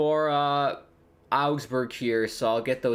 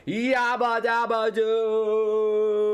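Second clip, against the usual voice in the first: very slow, higher drawn-out cartoon whoop; goal celebration; 8 semitones higher, much slower than usual. Real, not acted. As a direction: stretch the cheer out in pure joy.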